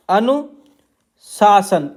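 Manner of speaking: अनुशासन stretched very slowly, syllable by syllable; अनुशासन said slowly, each syllable held; drawn-out dictation. Shown as a demonstration. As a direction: spell out अनुशासन slowly while writing it. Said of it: "तो इसे आप पढ़ेंगे अनुशासन".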